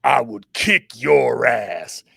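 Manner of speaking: put-on low, slow gravelly growl of an old legend; gravelly Bill Russell. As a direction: lean into a deep, slow, rough threat that sounds half like a joke. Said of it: "in his gravelly voice".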